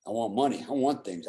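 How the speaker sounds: ordinary talk, nothing marked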